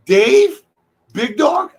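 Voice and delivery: loud, high rising questions; astonished recognition. Retold or imitated. Wrestler suddenly recognizing an old colleague, astonished. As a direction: act out spotting a long-lost friend.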